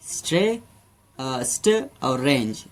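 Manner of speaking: "स्ट और रेंज" धीरे, टुकड़ों में; दो हिस्सों में. Shown as a demonstration. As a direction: strange को "स्ट", ठहराव, "रेंज" में बाँटें. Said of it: "दो हिस्सों में इसे हम". (पहले "स्ट्रे" पर अटककर, फिर "स्ट… और… रेंज")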